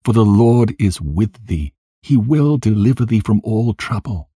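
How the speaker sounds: a touch of grief in a consoling prayer; understated lament, urging and consoling; mildly weeping, praying. A narrator's reading. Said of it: "with a voice weeping and praying in these words".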